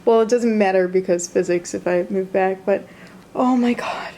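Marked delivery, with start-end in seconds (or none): 3.3-4.1 awed whisper. Real, not acted. Looking at herself in the mirror, an awed whisper. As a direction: breathe it out in wonder.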